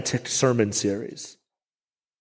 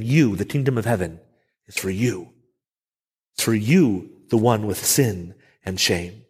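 tender reassurance; 6 semitones lower than usual. Pretend. Jesus welcoming the broken.

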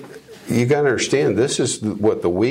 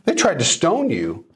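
disciples protesting; 8 semitones higher, faster than usual. Retold or imitated. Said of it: "his disciples said".

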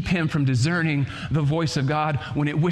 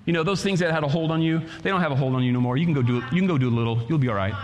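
enemy's voice; 2 semitones lower, faster than usual. Acted. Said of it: "the enemy comes and he speaks to you".

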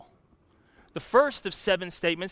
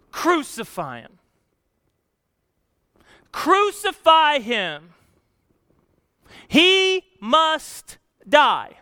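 building from a firm call to a much louder, higher, slow roar; crowd yelling; 9 semitones higher, 10 dB louder, much slower than usual. Retold or imitated. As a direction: chant it like an angry mob, swelling each time. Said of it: "the crowd yells back at Pilate".

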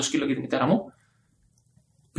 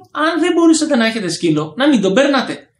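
much higher, louder reprimand; retold scolding; 8 semitones higher, 4 dB louder than usual. Scolding the neighbour about her loose dog, raised voice.

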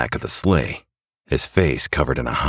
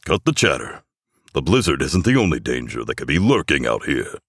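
growled order. A narrator's reading. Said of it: "growls Claus".

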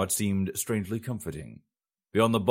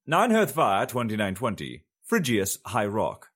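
date heading; 3 semitones higher, 3 dB louder than usual. A narrator's reading. Narrator opening a new chapter, announcing the date. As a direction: read it like a chapter title.

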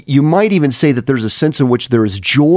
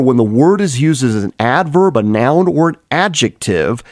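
ordinary delivery

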